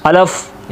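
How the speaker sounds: अलफ clipped, second vowel dropped; wrong alif. Shown as a demonstration. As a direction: mispronounce it as अलफ. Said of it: "ये भी गलत है".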